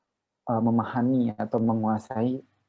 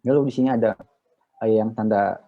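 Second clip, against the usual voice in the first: usual speaking voice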